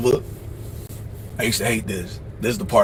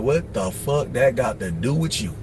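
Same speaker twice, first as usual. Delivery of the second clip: normal talking voice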